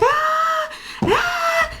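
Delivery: very high, drawn-out mock child's scream; high mock tantrum wail; imitated toddler scream. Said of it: "the other one's screaming".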